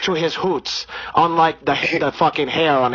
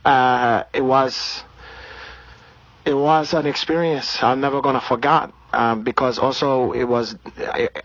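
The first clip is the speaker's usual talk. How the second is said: put-on Brazilian accent. Comedian holding a put-on Brazilian accent.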